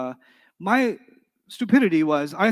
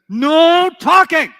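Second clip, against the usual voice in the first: much higher, louder and slow "No talking!"; commanding shout; 11 semitones higher, 12 dB louder, much slower than usual. Shown as a demonstration. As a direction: bark the order to silence the class. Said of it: "Then I said".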